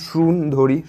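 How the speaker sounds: সুন্দরী drawn out slowly, sound by sound; teacher slowly spelling out সুন্দরী for the class; slow, broken into sounds. Shown as a demonstration. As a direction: stretch সুন্দরী so each sound is heard. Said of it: "তুমি যদি ক্ষুদ্র ক্ষুদ্র অংশ যদি ভাঙো".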